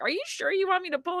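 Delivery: high, quick, anxious check; worried question. Retold or imitated. Mother in labor checking on the midwife, high and fast.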